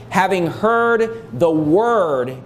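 very slow, deliberate lead into "word"; slow right down and set "word" apart; stress on "word". Shown as a demonstration. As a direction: draw out "the word" for emphasis. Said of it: "Notice how the emphasis once again is on the word of God".

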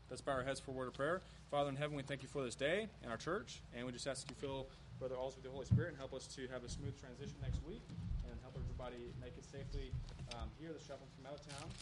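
soft, quick murmured prayer; quiet prayer. Real, not acted. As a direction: pray softly and quickly, heads bowed. Leads a closing prayer.

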